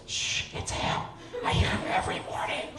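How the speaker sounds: conspiratorial whisper, quick. Retold or imitated. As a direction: hiss it urgently and secretly. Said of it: "the woman goes".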